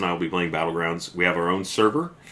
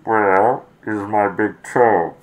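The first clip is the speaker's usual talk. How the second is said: slow, louder masked-villain moan; Bane voice; 3 semitones higher, 9 dB louder, much slower than usual. Acted. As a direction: growl it like a masked supervillain. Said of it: "why it became Bane all of a sudden".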